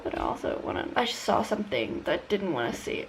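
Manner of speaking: ordinary delivery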